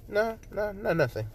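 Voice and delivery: mock-innocent denial; quick brush-off. Character brushes off his remark.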